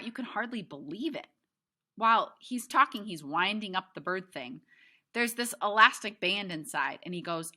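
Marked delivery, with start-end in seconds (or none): none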